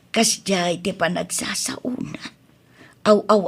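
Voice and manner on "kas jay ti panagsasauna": quiet, breathy whisper; soft whisper. Acted. Wife whispering to husband so the child won't hear, hushed. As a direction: keep it under your breath.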